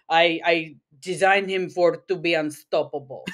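put-on thick Russian accent, villain scientist; proud mad scientist with Russian accent. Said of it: "I like to quote Lilo and Stitch in, in the Russian acc-, accent".

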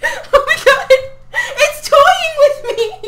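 real high-pitched panicked shriek; screaming. Real, not acted. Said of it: "I'm sorry for screaming".

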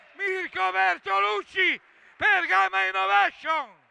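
high shouted goal commentary; goal call. Real, not acted. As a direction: yell the goal and scorer. Goal called out.

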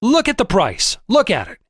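narrated angry outburst; exploding in anger. A narrator's reading. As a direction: explode at him over the price. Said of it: "before he exploded".

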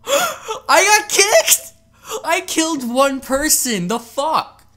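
high, loud, slow wail of disbelief; outraged disbelief. Real, not acted. Just kicked from the match after one kill, crying out in outrage. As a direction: cry out in shocked outrage.

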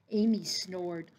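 ordinary delivery

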